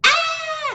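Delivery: very high startled squawk; bird shriek. Acted. Bird startled by the pouncing cat, high squawk. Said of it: "says the bird".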